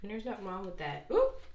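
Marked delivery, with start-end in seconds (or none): 1.1-1.4 sudden high yelp of surprise; a quick startled oop. Real, not acted. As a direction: jump at something unexpected.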